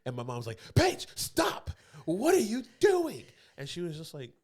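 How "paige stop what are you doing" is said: high, loud exasperated shriek, drawn out; hammed-up motherly scolding; scolding mom. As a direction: imitate a horrified mother telling someone off. Acts out mom scolding Paige.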